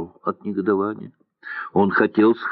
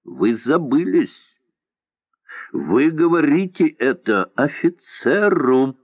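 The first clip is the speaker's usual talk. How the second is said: stern rebuke; 6 semitones higher than usual. A narrator's reading. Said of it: "строго сказал Мандер".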